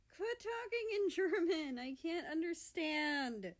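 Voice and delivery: high, loud slow whine; whining. A narrator's reading. America to the brothers, high slow whine. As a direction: plead childishly to be included. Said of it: "America whines".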